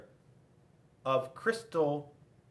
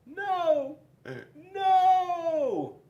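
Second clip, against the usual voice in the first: very high squealing no; high anguished wail; 7 dB louder than usual. A narrator's reading. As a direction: wail in defeat. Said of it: "And the apricot said".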